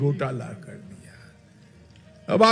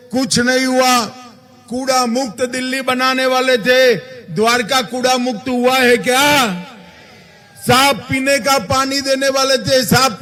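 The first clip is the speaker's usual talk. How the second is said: booming political rally speech. Acted.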